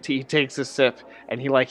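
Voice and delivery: usual speaking voice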